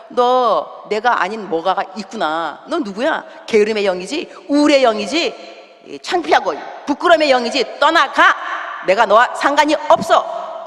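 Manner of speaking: demonstrated deliverance prayer, building in force; stern rebuke. Shown as a demonstration. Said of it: "이렇게 말하는 거죠". (영을 꾸짖으며 점점 세지는 단호한 목소리)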